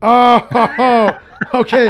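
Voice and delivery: high, loud laughing okay; real laughing surprise. Real, not acted. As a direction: laugh it off, caught out. Laughing at a globe reveal.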